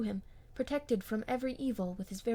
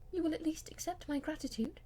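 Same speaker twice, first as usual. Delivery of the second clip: tender; 7 semitones higher than usual. Acted. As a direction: lean close and speak tenderly. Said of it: "speaking with real tenderness".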